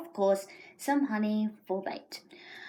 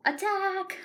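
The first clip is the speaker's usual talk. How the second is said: high dramatic Rabbit; 9 semitones higher than usual. Acted. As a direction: pitch it up for the attack. Rabbit voiced in story.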